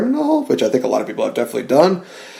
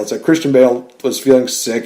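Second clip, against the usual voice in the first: usual speaking voice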